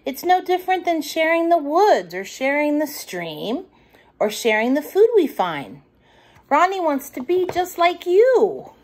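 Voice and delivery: a hint of a soothing mum; motherly voice. Mother continues explaining.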